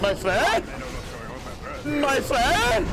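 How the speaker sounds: playful exaggerated questioning call; catchphrase voice. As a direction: swoop up on "friend". Playful catchphrase during the fight.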